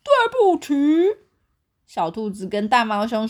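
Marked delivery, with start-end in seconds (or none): 0.0-0.7 high, bright bear voice 对不起; silly bear voice. A narrator's reading. Bear wrongly saying sorry to the crocodile. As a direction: say it in the bear's goofy voice.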